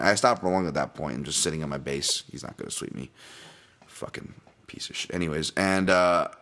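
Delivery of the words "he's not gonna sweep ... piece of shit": whispered, barely audible curse; under-breath mutter. Retold or imitated. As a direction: hiss it under your breath, unvoiced. Muttered insult under his breath.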